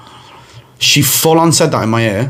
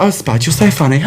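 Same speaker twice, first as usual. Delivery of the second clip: high coy voice into put-on giggle; mock flirty mum; 11 semitones higher than usual. Friend's mum flirting with Spud, high coy voice and fake laugh. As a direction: imitate a flirting mum, pitched up, then laugh.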